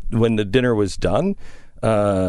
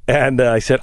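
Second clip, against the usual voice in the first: ordinary talk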